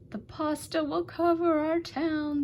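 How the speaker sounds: crying out. A narrator's reading. The people cry out about the pasta, high alarmed cry. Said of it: "they cried".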